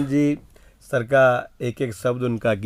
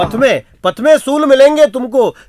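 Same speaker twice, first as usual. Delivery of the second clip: higher, louder declaimed repeat; loud poem recital; 8 semitones higher, 6 dB louder than usual. Acted. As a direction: belt the verse out. (ऊँचे स्वर में कविता पाठ)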